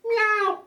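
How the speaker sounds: high, loud meowing cat. Shown as a demonstration. Man doing funny animal noises, high loud meow. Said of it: "F A N means funny animal noises".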